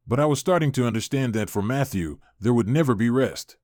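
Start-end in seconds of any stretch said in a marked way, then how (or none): none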